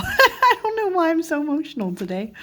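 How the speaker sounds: laughing, voice climbing very high; overwhelmed, laugh through it in a high voice; real emotional. Real, not acted. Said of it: "I'm emotional".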